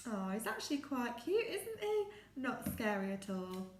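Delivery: normal talking voice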